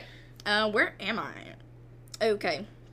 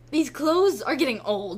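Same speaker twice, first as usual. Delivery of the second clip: lightly whiny complaining mourner; 5 semitones higher, 8 dB louder than usual. Retold or imitated. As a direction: moan it like a bored mourner. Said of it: "I'm like".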